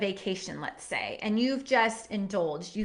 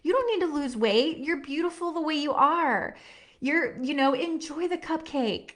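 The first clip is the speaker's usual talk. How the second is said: tender reassurance; 3 semitones higher than usual. Retold or imitated. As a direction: soothe her like your little girl. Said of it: "cup her hand and be like".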